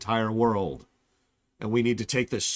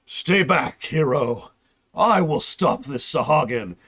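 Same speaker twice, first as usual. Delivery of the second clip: heroic warrior; 4 semitones higher, 6 dB louder, slower than usual. Retold or imitated. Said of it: "and Estinian's like".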